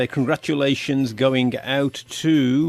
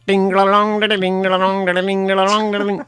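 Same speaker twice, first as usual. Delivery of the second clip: higher, monotone looping jingle; imitated van chime; 4 semitones higher than usual. Ice cream man pulls up outside houses, imitated chime. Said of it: "I pull up ... and I go".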